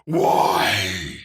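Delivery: normal talking voice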